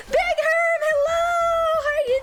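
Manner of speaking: high, loud, gleeful hello; excited greeting. Real, not acted. Streamer greeting a viewer who just arrived, excited squeal. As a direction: call out a hello with voice way up.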